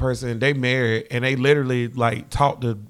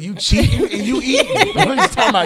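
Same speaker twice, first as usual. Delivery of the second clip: high, loud laughing talk; 11 semitones higher, 5 dB louder than usual. Real, not acted. Friend riffing on cheating and eating, laughing. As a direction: crack up while teasing, pitch shooting up. Joking on the link rhyme.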